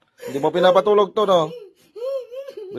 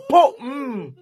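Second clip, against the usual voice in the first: very high, explosive "po" and trailing hum; imitated whip crack; 10 semitones higher, 3 dB louder than usual. Real, not acted. Healer threatening to whip spirits, imitated lash sound. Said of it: "Lalatiguin kita".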